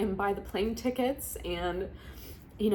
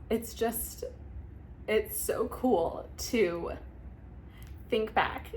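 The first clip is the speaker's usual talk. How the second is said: slow, soft, holding back tears; trying to steady a wavering voice; choked up; 3 semitones higher, 3 dB quieter, slower than usual. Real, not acted. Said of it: "getting emotional".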